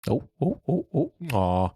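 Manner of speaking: ordinary delivery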